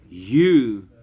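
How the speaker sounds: normal talking voice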